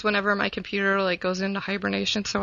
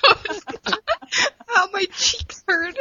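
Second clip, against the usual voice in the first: laughing; 9 semitones higher, 4 dB louder than usual. Real, not acted. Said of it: "I'm laughing so hard I'm crying".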